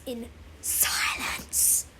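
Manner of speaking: stretched whisper; hushed. A narrator's reading. Narrator telling how everyone listens in silence, hushed. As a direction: let it fall to a slow whisper.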